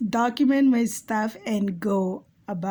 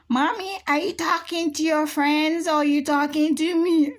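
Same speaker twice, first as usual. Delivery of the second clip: put-on higher-pitched child asking mommy; imitating her son; 5 semitones higher than usual. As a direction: voice a puzzled kid's question, pitched up. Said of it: "My son did".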